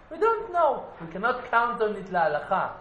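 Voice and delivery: high-pitched disclaimer. Acted. Rabbis disclaiming the heavenly ruling, high-pitched protest. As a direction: throw it out high and loud.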